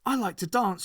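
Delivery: ordinary delivery, nothing marked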